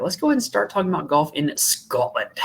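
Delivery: amateur stab at a Scottish accent. Shown as a demonstration. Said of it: "That was my best Scottish accent".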